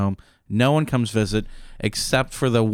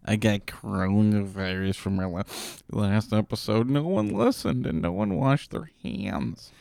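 weak, hammed-up sick voice, quieter; faking frailty; 4 dB quieter than usual. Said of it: "he's pretending to be sick".

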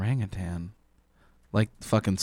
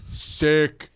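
mock bro reply; 4 semitones higher, much slower than usual. Orangutan thanking his buddy, mock bro voice. Acting out the dead ape's reply.

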